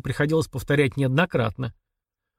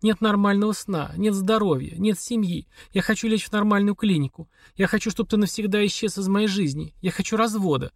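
a hint of a higher, pleading wife's voice; lightly voiced wife Olga; 7 semitones higher than usual. A narrator's reading. Wife demanding a divorce from her husband, lightly voiced. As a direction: read her lines a little higher, worn out and insistent. Olga's voice in the dialogue.

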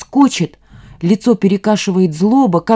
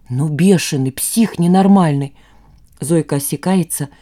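usual speaking voice

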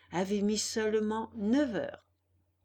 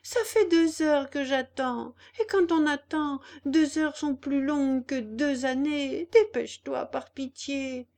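put-on high, pleading voice for a freezing boy; pleading puppet; 6 semitones higher, 5 dB louder than usual. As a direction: beg to be let in, voice raised high. Puppet pleads after two hours.

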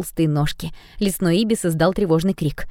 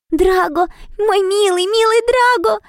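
adoring squeal; 4 dB louder, slower than usual. Acted. Mari adoring the dragon.

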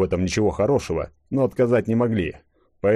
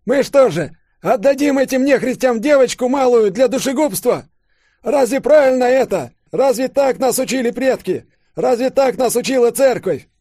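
high, loud impassioned appeal; old man appealing; 7 dB louder than usual. A narrator's reading. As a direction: shame the crowd into action. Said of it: "вдруг воззвал к деревенским Савельич". (страстный призыв старика к деревенским)

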